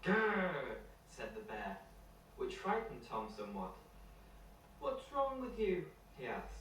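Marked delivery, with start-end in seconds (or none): 0.0-0.8 a playful storybook growl, higher and a bit louder; bear growl. Acted. 4.8-5.9 put-on a much higher childlike voice, drawn out slowly; slow little boy Tom. Retake of Tom's line.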